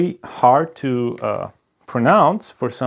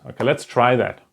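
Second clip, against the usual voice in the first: normal talking voice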